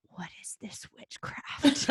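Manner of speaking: whispered mock horror; slow, stunned whisper to yourself; hushed disbelief. Retelling a shocked realization mid-drink.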